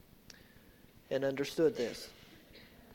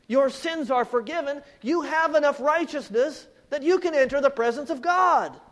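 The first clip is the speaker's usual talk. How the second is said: louder, higher sermon voice; full-force preaching; 8 semitones higher, 9 dB louder than usual. Acted. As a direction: drive the point home to the church.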